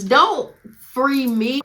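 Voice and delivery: high, loud and drawn-out protest; mock upset protest. Teen mad at friends for posting, drawn-out loud protest. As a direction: stretch it out high and loud like she's fed up. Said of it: "I was so upset".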